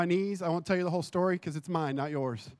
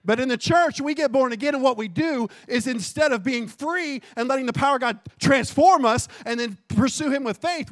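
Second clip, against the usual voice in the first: loud, raised pulpit voice; fervent preaching; 7 semitones higher, 7 dB louder than usual. Acted. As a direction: press the congregation with urgent conviction. Preaches at full force.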